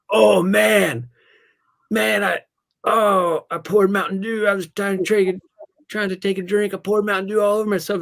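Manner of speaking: loud, high, frantic fake excuse; panicked kid. Boy to his buddy, faking a panicked spill. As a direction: play the boy covering his accident in a panic. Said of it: "and I'm like".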